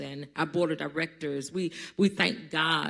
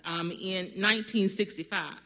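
ordinary talk, nothing marked

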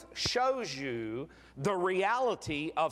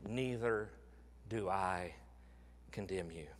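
soft Jesus voice; 10 semitones lower, 10 dB quieter than usual. Acted. Preacher voices Jesus forgiving woman.

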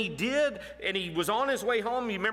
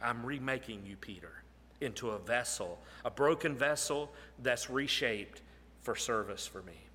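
low, soft and reassuring; gentle voice of Jesus; 7 semitones lower, 6 dB quieter than usual. Acted. Jesus reassuring broken Peter, softly. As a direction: lean in and comfort him.

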